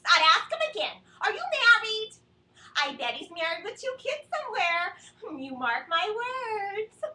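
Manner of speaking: loud insistent mom voice, pressing hard; nagging mom. Retold or imitated. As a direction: hammer it home like a suspicious mother.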